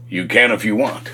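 low, soft divine reassurance; powerful, peaceful voice. Retold or imitated. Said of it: "with all the power came this incredible peace".

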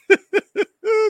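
short burst of high laughter. Real, not acted. Laughs after announcer bit.

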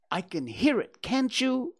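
high, nervous little-pig voice; anxious Piglet. A narrator's reading. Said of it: "said Piglet anxiously".

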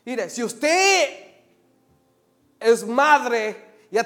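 loud, high, impassioned sermon; full-force preaching. Real, not acted.

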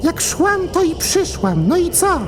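very high, eerie demon woman; eldritch horror. Acted. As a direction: taunt them with an unearthly voice. Naked demon woman arrives. (upiorny głos diablicy)

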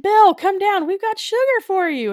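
loud, high-pitched holler upward; mock calling out. Coaxing Bill down off the building, mock shouted plea. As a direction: call up to someone far away, hammed-up. Retold coaxing of giant ant.

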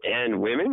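"women" rising as a question; echoed question. Retold or imitated. Host echoing co-host's sign-off, "women" as a question. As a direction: echo it back, lifting "women" in doubt. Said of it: "You can say that in a question".

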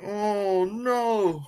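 high, drawn-out mock wail; wailing dismay. Co-host recalling the ping pong stunt, wailing dismay. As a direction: moan it high as if pained for them. Retold reaction to painful stunt.

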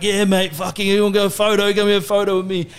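high-pitched mock bogan Aussie; bogan Aussie accent. Bogan mate offering strangers a photo, broad Aussie accent. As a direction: imitate a bogan Aussie from way out west. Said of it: "he can't put on an accent to save his life".

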